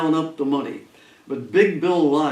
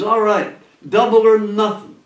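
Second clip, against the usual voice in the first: louder, higher retold challenge; mad; 7 semitones higher, 6 dB louder than usual. Club owner angrily taking the gambler's bet, mad. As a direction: imitate a riled gambling boss raising his voice. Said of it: "This made Big Bill Elias mad".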